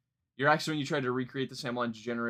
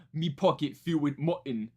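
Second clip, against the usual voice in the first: lightly done British rapper accent, mimicking the lyric; a hint of British accent quoting the line; mock British accent. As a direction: say it like a London grime MC. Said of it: "the way they say it is so funny".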